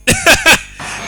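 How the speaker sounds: very high burst of laughter; real, squealing laugh; high giggle. Real, not acted. Narrator laughs at Gaddafi's order.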